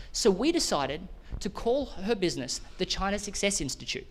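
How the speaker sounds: normal talking voice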